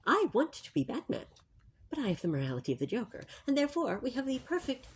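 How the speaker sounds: hushed library voice, barely above a breath; library whisper. Real, not acted. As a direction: keep it soft so nobody in the library is disturbed. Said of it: "we always use our library voices in the library".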